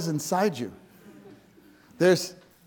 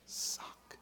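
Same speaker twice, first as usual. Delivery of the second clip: whisper; 17 dB quieter than usual. Real, not acted. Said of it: "God cares for your sock".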